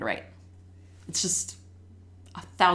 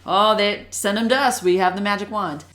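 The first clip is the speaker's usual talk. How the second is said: loud, raised mock boast; joking; 3 semitones higher, 7 dB louder than usual. Reading specialists joking about struggling readers, mock-confident and loud. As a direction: call it out as a running joke. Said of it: "me and Laura would joke about it".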